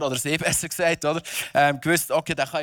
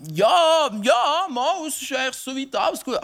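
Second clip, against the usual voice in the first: unconvinced, high-pitched hedging; hammy stage actor; 8 semitones higher, 4 dB louder than usual. Pretend. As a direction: nervously play it down. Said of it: "das ist nicht so überzeugt". (theatralisch, wenig überzeugt)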